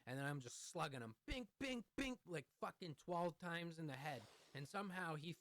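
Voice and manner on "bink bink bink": quick "bink" hits, pitched way up; imitated punch sounds. Retelling a fight to listeners, high mock punch noises. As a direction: mimic each blow with a high, springy bink.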